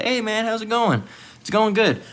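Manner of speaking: high, easygoing mock pleasantries; friendly greeting. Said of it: "seeing Jake in a good state".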